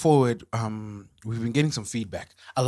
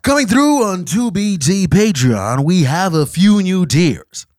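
booming announcer voice; grand announcement; 6 semitones higher, 10 dB louder than usual. Acted. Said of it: "this is a grand announcement".

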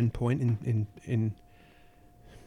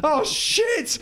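panicked; 9 semitones higher than usual. Acted. Climbing down, landing on friend.

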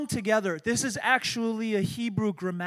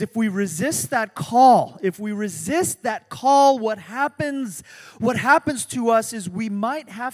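forceful preaching; 2 semitones higher, 8 dB louder than usual. Acted. Preacher building to a warning.

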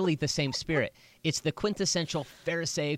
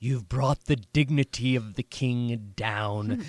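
preacher voice; slower than usual. Retold or imitated. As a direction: preach it like a pulpit scold. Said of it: "That's your preacher voice".

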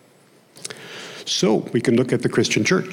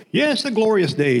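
put-on a lightly higher young Okie lady, in an Oklahoma twang; young lady's voice; 9 semitones higher than usual. Preacher voicing the lady on the bridge, in a higher Oklahoma twang.

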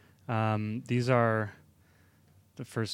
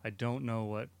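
normal talking voice

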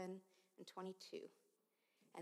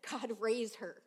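loud plea to God; desperate prayer shouted over his daughter; retold shout; 2 semitones higher, 9 dB louder, slower than usual. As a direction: cry it out to God. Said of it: "I shouted".

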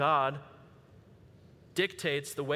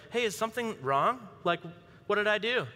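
higher-pitched, innocent questioning; puzzled husband; 6 semitones higher than usual. Retold or imitated. Husband asks his upset wife what is wrong, high puzzled voice. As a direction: play a mildly clueless husband checking in. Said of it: "kind of like the husband".

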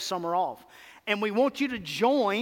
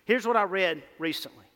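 ordinary talk, nothing marked

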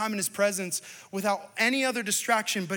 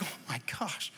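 awed; 4 semitones lower, 10 dB quieter than usual. Retold or imitated. Father moved by son's words.

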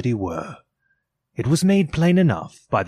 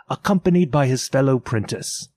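ordinary delivery

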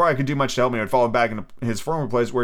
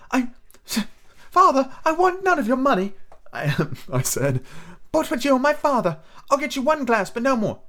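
put-on much higher boy's voice, hesitant; a boy trying to object; high young lad's voice; young Jim; 14 semitones higher than usual. Narrator voicing young Jim.

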